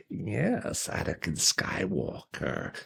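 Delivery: slow, soft villain croak; hammed-up sinister impression, drawn out and hushed; evil Emperor. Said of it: "That's a pretty terrible accent".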